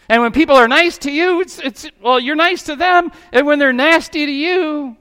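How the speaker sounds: high, loud, quick preaching build; preaching at full force. Acted. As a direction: drive it home to the congregation.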